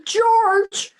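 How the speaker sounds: loud frantic cry of a name; panicked uncle crying out for George; distressed shout. Acted. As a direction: call out for help in panic. Billy calls out in distress.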